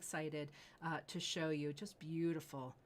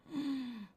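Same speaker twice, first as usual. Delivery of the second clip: gasp; 8 semitones higher, 4 dB louder than usual. Real, not acted. Host reveals jade ring.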